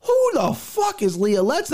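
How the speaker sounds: high, rising outraged question; indignant disbelief. Retold or imitated. Retelling first seeing the fight line, high incredulous outburst. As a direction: throw it up high like it's absurd. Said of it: "I said".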